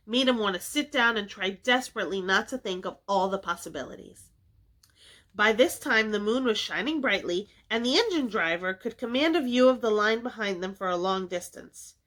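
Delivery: plain reading voice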